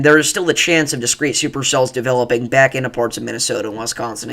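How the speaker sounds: usual speaking voice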